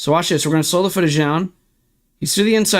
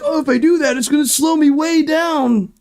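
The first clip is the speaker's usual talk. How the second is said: crybaby mock whine; 8 semitones higher than usual. Streamer mocks racers' excuse as fake sobbing. Mocking drivers' excuse again.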